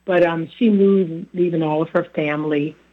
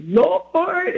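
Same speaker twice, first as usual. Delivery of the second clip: Lord over three notes; 12 semitones higher than usual. Retold or imitated. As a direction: Lord sung high across several notes. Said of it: "like it had about three different, like, notes to it".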